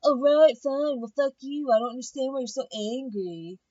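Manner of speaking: high, huffy put-on voice; imitating a drunk roommate's hissy fit; mock defensive Jamie. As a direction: brush them off with a snotty tone. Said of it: "she was like".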